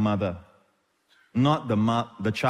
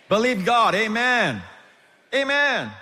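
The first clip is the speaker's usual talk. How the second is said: rising exhortation; 8 semitones higher, 4 dB louder, slower than usual. Real, not acted.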